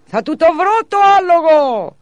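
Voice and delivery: put-on high, loud, slow child's yell. A narrator's reading. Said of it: "φώναζε πιο δυνατά". (δυνατή, ψηλή παιδική κραυγή)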